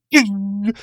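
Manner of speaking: high, loud imitated ding; ding sound effect. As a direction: chime it out like a bell. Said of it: "he goes".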